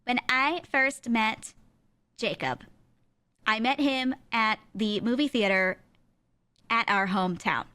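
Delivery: mock stilted speech reading, pauses between phrases; reading-off-paper cadence. Mocking guests reading their vows, stilted reading cadence. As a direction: read it off the page in halting, measured chunks. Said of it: "that reading off of a paper cadence".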